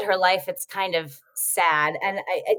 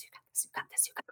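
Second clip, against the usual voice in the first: hushed encouraging whisper; 20 dB quieter, much faster than usual. Retold or imitated. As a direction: breathe it out under your breath. Retold nervous cheering from offstage.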